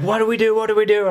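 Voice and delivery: high, urgent repeated plea; panicked. Retold or imitated. Asking friend about the sick passenger, in retold panic. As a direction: act out not knowing what to do.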